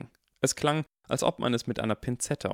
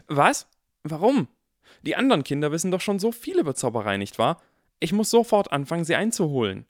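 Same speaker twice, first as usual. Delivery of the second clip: put-on higher, louder, fast alarmed boy; alarmed eagerness; 7 semitones higher, 5 dB louder than usual. A narrator's reading. Harry protests to McGonagall, alarmed and urgent. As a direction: blurt it out in a panic to catch up.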